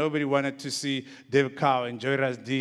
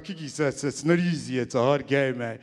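Ronnie Aptieka. Retold or imitated. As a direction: do the friend's accent at ordinary pitch. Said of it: "he speaks in a strong accent".

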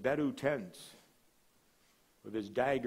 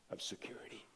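breathy whispered trail-off; 3 semitones lower, 13 dB quieter, faster than usual. Acted. Pastor voicing a fearful believer's plea, whispered. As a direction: drop to a whisper.

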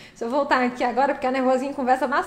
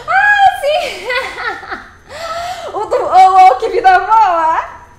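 mock excited outburst; 6 semitones higher, 7 dB louder, much slower than usual. Mock singing her own audio.

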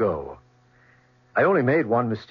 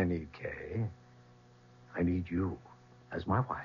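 much softer, low and close; intimate murmur; 5 semitones lower, 13 dB quieter than usual. Acted. Boss quietly proposing to his secretary, low and intimate. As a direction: say it close, just for her.

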